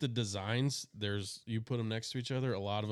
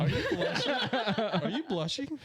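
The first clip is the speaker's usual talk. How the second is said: laughing tease; 13 semitones higher than usual. Real, not acted. Teasing friend on air.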